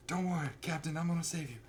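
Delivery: high, soft Southern reassurance; mock Southern accent; Southern boy. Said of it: "he's, like, a Southern boy".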